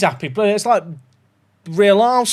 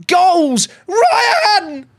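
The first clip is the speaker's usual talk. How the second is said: shouted high with a laugh; goal celebration; 13 semitones higher, 5 dB louder than usual. Real, not acted. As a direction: yell his name in delight.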